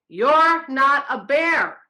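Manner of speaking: pointing finger, enraged; furious. Acted. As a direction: jab each word with fury. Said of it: "He was furious".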